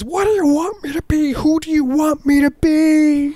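put-on very high, slow wailing child voice; crying kid. Upset boy screaming at other kids, high wailing child voice. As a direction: wail it high like a sobbing seven-year-old. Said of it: "he was crying and he was screaming".

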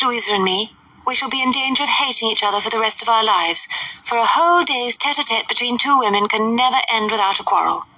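a hint of a society lady; gushing letter writer. A narrator's reading. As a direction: read it like a fashionable friend. Letter read in character.

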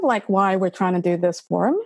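normal talking voice

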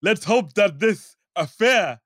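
hammed-up, stately African accent; mock Nigerian accent. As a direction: stretch each word in a put-on African accent. Mimics the wrestler's pointless accent.

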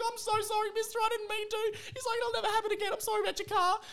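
put-on very high, timid kid voice apologising; scared kid. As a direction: squeak out the apology like a frightened boy.

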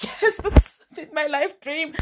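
high, slow, gushing with joy; overjoyed, high and drawn out; excited. Pretend. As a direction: squeal it like a dream come true. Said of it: "I'd be so excited".

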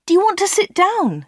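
high-pitched solicitous question; concerned. A narrator's reading. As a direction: offer a seat, voice lifted. Anna worries for crying customer.

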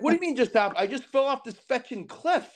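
high-pitched, incredulous outburst; acted indignation at normal volume; retold exasperated snap. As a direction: replay being fed up with a clueless friend. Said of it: "I'm ready to snap".

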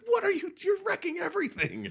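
high-pitched mock-exasperated yell; exasperated protest. Luke to the toad guy tossing his things, exasperated. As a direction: wail in outrage at the mess. Luke yells at Yoda wrecking camp.

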